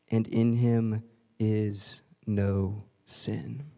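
slow, solemn Bible reading; scripture reading. Acted. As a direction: read the verse slowly, with weight.

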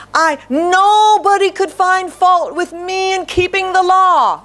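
a preacher voicing Saul's boastful pride, pitch climbing; proud Saul. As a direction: crow about your own righteousness, climbing in pitch.

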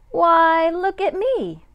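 high, loud exclamation of surprise; surprised discovery. A narrator's reading. Teacher reading the twist ending to the class, surprised. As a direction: gasp out the discovery. Twist reveal: narrator is moose.

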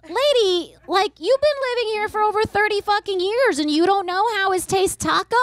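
high mock-exasperated retort. Niece mocking her aunt over tacos, mild exasperation. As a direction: scoff at her, mildly fed up. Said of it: "I was like".

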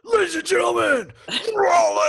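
high, loud arena announcer shout; announcer bellow. Pretend. Introducing the band to the crowd, booming announcer voice. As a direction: hype the crowd like a stadium MC. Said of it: "you make that voice".

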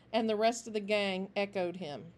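ordinary delivery

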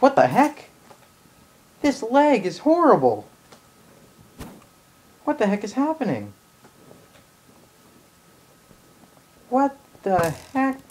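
gamer's annoyed outburst; exasperated rant. Real, not acted. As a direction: rant at the lagging game. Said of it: "sorry for all the ranting".

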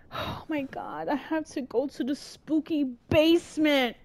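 ordinary talk

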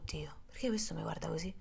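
anxious unvoiced whisper; scared inner voice on the date; whispered worry. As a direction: whisper your panic to yourself.

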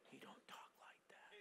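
hushed, voiceless whisper. Shown as a demonstration. Preacher showing God doesn't shout, whispering. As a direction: drop right down to a whisper. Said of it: "gentle whisper".

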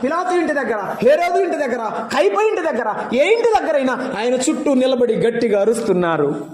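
high, ringing preacher's cry; preaching at full force. Acted. A preacher lifting his voice to the congregation. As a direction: proclaim it to the whole hall.

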